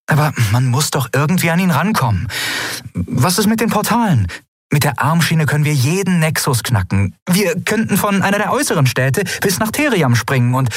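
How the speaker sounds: high, eager little voice; small excitable companion. Acted. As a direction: rattle out ideas in a high voice. Voices Nelen pressing an idea. (hohe, eifrige Piepsstimme)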